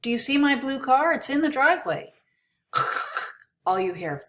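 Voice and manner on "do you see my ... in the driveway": fast, higher, louder call over static; frantic phone yell. Pretend. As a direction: push it out fast to beat the noise. Yelling down a bad line.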